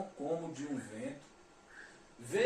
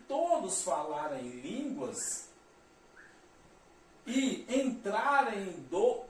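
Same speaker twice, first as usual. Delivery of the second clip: raised, slow reading of the verse; solemn scripture read; 4 semitones higher, 4 dB louder, slower than usual. Acted. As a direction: read the Bible verse out with weight. Reads scripture aloud.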